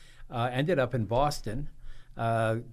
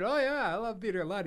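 eager yes; 10 semitones higher than usual. Retold or imitated. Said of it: "I said".

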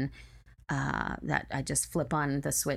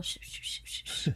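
soft unvoiced sh scratching; pencil scratching noise; 8 dB quieter than usual. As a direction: imitate a pencil scratching on paper. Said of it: "drawing with her pencil".

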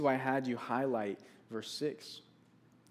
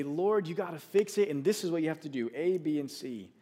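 louder, higher mock-frustrated orders to God, fast; frustrated demand; 4 semitones higher, 4 dB louder than usual. Preacher mimicking his own impatient prayer, frustrated and hurried. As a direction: rattle off demands at God, exasperated. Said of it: "to get frustrated and say".

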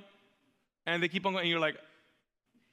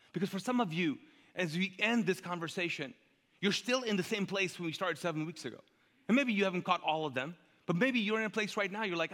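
ordinary talk, nothing marked

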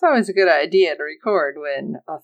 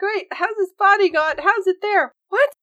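high-pitched, bewildered questioning; mock bafflement; 12 semitones higher than usual. Podcaster voicing the crew finding Lloyd's body, mock bafflement. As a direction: imitate characters thrown into high-pitched confusion.

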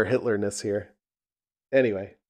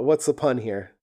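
usual speaking voice

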